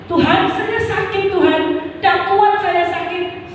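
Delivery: high, slow, strained cry to God; pained plea. Retold or imitated. Preacher reliving his herpes pain, crying out to God. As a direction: beg God like a man in pain. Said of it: "Saya pun belajar".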